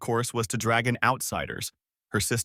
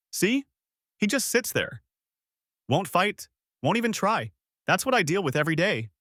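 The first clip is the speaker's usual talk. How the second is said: mocking; 7 semitones higher than usual. A narrator's reading. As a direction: play it up for the audience. Wife mocking husband before audience.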